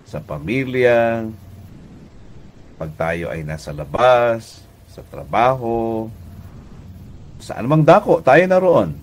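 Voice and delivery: ordinary delivery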